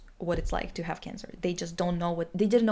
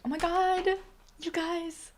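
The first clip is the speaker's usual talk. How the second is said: excited squeal; 10 semitones higher, slower than usual. Real, not acted. Telling friends the prayer circle worked, excited. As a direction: gush it out, thrilled. Sudden joyful outburst to friends.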